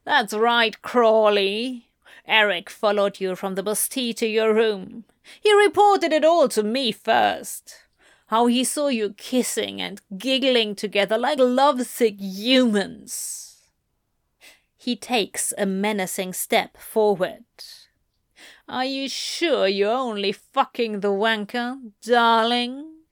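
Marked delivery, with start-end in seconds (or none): 0.0-13.5 gloating demon duke; mocking, put-on villain voice; leering taunt. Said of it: "peel back in an ugly leer". 18.7-23.0 put-on villain's taunt; leering mock endearment. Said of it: "bastardization of that endearment".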